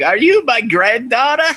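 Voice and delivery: high, loud old-lady impression.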